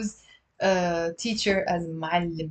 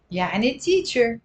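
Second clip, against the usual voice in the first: ordinary talk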